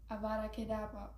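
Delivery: usual speaking voice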